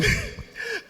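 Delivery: high, quiet giggle; real laugh. Real, not acted. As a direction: giggle helplessly at your own story. Amused by his own story.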